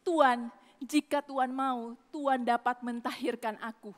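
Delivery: soft, low humble request; polite plea. Retold or imitated. Leper humbly asking the Lord, soft and polite. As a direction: murmur the plea gently and respectfully. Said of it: "Dia ngomong dengan sopan".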